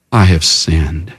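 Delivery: low, slow admission of guilt; subdued confession. Acted. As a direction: drop the pitch and confess slowly. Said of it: "says with a sigh".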